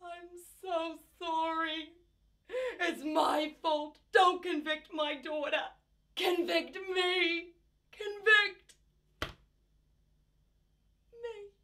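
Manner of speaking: high, crying, slow breaking voice; sobbing confession. Acted. Mother confessing in court, sobbing and pleading.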